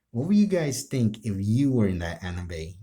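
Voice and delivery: ordinary talk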